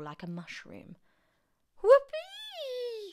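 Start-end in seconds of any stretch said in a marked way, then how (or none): 1.8-3.1 very high, loud whoop; gleeful shout. A narrator's reading. Grandma finds her voice at last, gleeful shouted whoop. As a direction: whoop at the top of your voice. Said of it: "she shouted".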